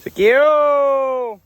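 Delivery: long high holler to the herd; calling cattle. Real, not acted. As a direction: call it out across the field. Calling cows across pasture.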